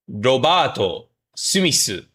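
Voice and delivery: ordinary delivery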